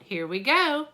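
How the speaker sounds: ordinary talk, nothing marked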